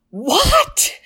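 screamed what. Retold or imitated. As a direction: blurt out "What?" as a sudden shout in the middle of a calm talk. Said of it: "he just screams out".

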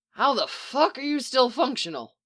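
high-pitched, incredulous outburst; incredulous demand. A narrator's reading. Lover breaking off a kiss in disbelief, demanding. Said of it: "he demanded".

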